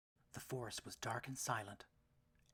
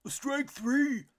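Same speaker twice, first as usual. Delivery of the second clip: loud, high umpire shout; umpire's call; 14 semitones higher, 15 dB louder than usual. A narrator's reading. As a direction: bellow the call across the field. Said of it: "cried the umpire".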